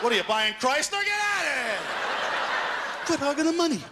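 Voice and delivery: high, loud comic rant; exasperated yelling. Acted. Waiting customer yelling at the man hogging the machine, exasperated. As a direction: yell it, stretching out the end. Acted yell at slow machine user.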